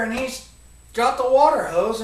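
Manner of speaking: ordinary delivery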